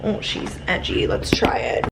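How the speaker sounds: a teasing aside whispered to herself; playful whisper. Real, not acted. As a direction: whisper it like a cheeky private joke. Teasing herself about the outfit.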